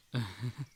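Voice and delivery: short soft breathy chuckle; a small quiet laugh to herself. Acted. Character laughs softly after admitting she's not good.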